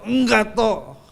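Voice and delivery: mild stubborn shout; stubborn denial. Retold or imitated. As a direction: dig in and refuse bitterly. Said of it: "dia tetap ngotot".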